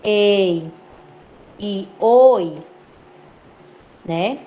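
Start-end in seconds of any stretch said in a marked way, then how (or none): 0.0-0.7 "ei" with an open é, held; sustained open-mouthed "ei"; open diphthong. Shown as a demonstration. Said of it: "dos ditongos abertos". 2.0-2.6 "oi" with an open ó, held; sustained open-mouthed "oi"; open diphthong. Shown as a demonstration. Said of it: "dos ditongos abertos".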